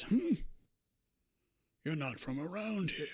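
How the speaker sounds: put-on high raspy hum, then a wary old man. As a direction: rasp it like a suspicious hermit. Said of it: "a raspy old voice".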